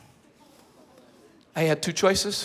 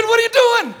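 higher, louder demand; demanding cop; 10 dB louder than usual. Retold or imitated. Officer questioning the man on the fence, loud demand. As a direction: demand an explanation.

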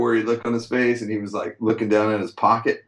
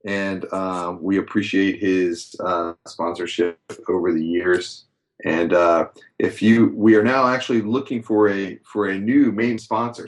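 ordinary delivery

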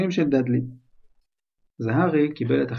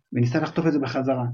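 ordinary delivery

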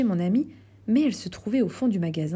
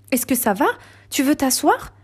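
higher, louder, anxious questions; worried exclaim; 6 semitones higher, 5 dB louder than usual. A narrator's reading. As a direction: rush in worried for her friend. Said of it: "s'exclama-t-elle".

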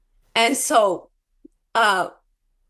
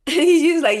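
bursting into a laugh, louder and higher; 7 semitones higher, 6 dB louder than usual. Real, not acted. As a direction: laugh it out, amused. Laughing at the Lord's joke.

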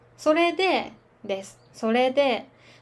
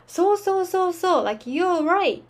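eager agreement; 6 semitones higher than usual. Shown as a demonstration. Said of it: "みたいな感じです".

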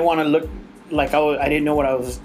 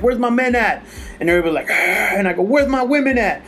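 higher and higher emcee call-out, building; hyping the crowd; 6 semitones higher than usual. Retold or imitated. Emcee working the concert crowd, rising hype call-out. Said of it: "my first joke was like".

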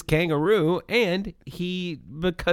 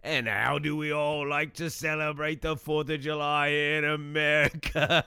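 put-on shitty Australian accent. Wallaby Wanker greeting podcast viewers in a hammed-up Australian accent. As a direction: play a cheesy Aussie safety mascot. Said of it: "your shitty Australian accent".

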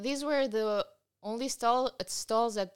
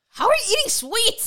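mock friend voice; 12 semitones higher, 5 dB louder than usual. Mocking friend's whine.

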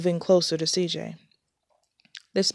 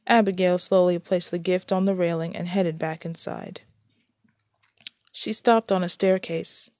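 ordinary delivery

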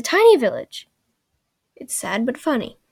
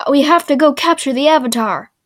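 loud, raised declaration; mock insistent Zuko; 4 semitones higher, 8 dB louder than usual. Zuko to the old man about the Avatar, loud mock insistence. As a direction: push it out as a comic demand. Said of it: "and then Zuko goes".

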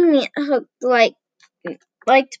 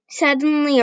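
plain reading voice

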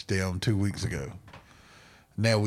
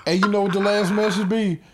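high, loud words through laughter; cracking up mid-story; can barely get it out for laughing; 12 semitones higher, 6 dB louder than usual. Real, not acted. Laughing while telling the story.

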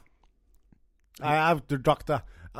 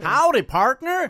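Texan howdy, pitched up; Texan cowboy; 8 semitones higher, 4 dB louder than usual. Acted. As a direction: greet him like a hammed-up Texas cowboy. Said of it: "I'm in Texas".

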